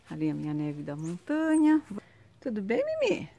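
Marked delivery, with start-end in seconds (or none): 2.4-3.3 higher sing-song pet talk; talking to the dog. Real, not acted. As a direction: coo a greeting up high to the little dog. Greets her dog.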